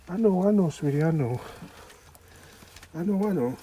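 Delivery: loud, high soothing repeats. Real, not acted. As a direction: comfort her loudly, over and over. Comforting upset pet.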